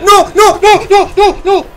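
rapid-fire high, loud cries; panicked shouting. Real, not acted. Hiding player is found, panicked shouts. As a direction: cry out in terror, over and over.

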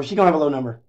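usual speaking voice

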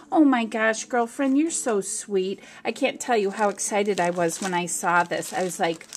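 ordinary talk

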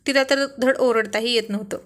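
usual speaking voice

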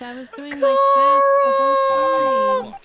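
high, loud whining cry; crying protest. Real, not acted. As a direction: wail it out, pleading innocence. Accused player protests.

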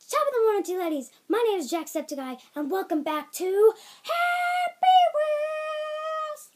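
very loud, high YouTuber intro; Jacksepticeye. Retold or imitated. Kid playing a YouTuber's game intro, shouted impression. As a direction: rattle it off, then scream the title long. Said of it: "I'm Jacksepticeye".

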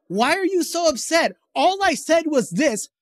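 high-pitched, indignant mock protest; defensive protest. Retold defensive reply to upset wife.

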